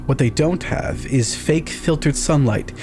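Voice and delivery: usual speaking voice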